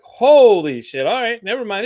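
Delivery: stunned and backpedalling; shocked blurt. Retold or imitated. Hearing the man lost his son, a loud slow shocked outburst. Retold shock at the bad news.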